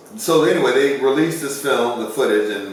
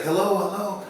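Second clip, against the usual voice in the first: calling hello; 10 semitones higher, 4 dB quieter than usual. Retold or imitated. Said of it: "He's, like, yelling at it".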